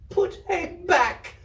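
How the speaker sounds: put-on very high, slow desperate child scream; little girl screaming at the man to undo it. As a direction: scream it out, begging. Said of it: "she screamed".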